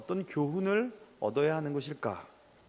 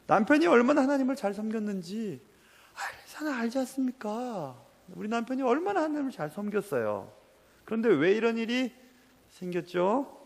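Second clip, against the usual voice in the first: higher, tearful-sounding plea, but no real sobbing; a widow's anguished why, pitched up; crying plea; 8 semitones higher than usual. Acted. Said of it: "하면서 막 울고 있는 겁니다". (울며 하소연하는 과부, 흐느낌 없이)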